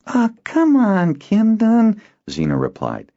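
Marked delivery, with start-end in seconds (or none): 0.1-2.0 high, loud, drawn-out plea; coaxing boy. Acted. Boy coaxing his friend, slow and pleading. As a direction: wheedle your friend.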